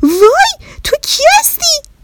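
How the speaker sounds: frightened bear shouting at her own reflection; frightened cry. Acted. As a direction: yelp in fright at the talking mirror. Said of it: "از ترس ... داد زد".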